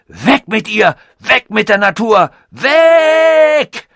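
very high, loud, frenzied shouting; roaring. A narrator's reading. Robot screams against nature while dragged away, roaring. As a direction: roar a fanatical rant, building to a long final scream. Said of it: "brüllte er, dass es im ganzen Park widerhallte".

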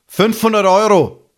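demanding. Acted. Stranger demands money.

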